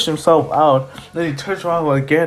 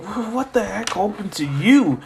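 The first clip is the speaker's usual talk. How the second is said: shocked question; 6 semitones higher than usual. Acted. Boy shocked by injury.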